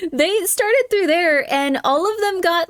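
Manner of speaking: high, slow, laughing words. Real, not acted. Laughs while explaining.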